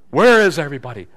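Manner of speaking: loud mock-exasperated outburst; exasperated cry. Overwhelmed Sunday school teacher calling for help, loud exasperation. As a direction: complain loudly like an overwhelmed volunteer.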